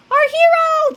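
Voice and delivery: high, loud adoring cheer; joyful shout. A narrator's reading. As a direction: shout it like kids cheering a rescuer. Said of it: "the boys shouted".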